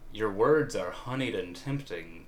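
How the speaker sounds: plain reading voice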